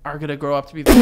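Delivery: ordinary talk, nothing marked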